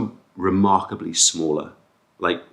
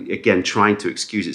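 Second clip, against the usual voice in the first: ordinary talk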